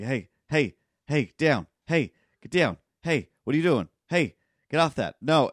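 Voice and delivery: loud, high, repeated scolding calls; mock exasperated yelling, each hey jumping up; imitating staff yelling. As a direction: bark short reprimands at unruly kids. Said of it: "They have to yell at them every two seconds".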